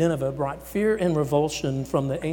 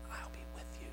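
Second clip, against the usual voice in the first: whispered promise; 19 dB quieter, faster than usual. Acted. Whispered closing of God's promise.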